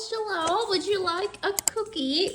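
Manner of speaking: high, soft sing-song coo to the dog; high dog-talk voice. Real, not acted. Owner coaxing her dog to sit, high cooing baby talk.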